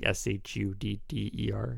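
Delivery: letters spelled slowly; spelling it out. Shown as a demonstration. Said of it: "called Shudder".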